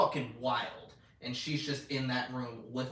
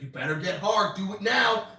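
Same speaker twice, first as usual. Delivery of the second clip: mock threat; 8 semitones higher, 9 dB louder than usual. Homelander's threatening demand.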